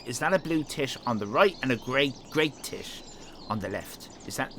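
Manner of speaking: ordinary delivery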